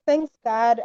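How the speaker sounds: ordinary delivery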